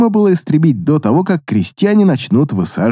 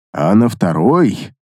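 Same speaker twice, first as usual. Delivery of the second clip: slow, drawn-out and pondering; thoughtful drawl; 6 semitones lower, slower than usual. A narrator's reading. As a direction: stretch it out, musing. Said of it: "задумчиво протянул".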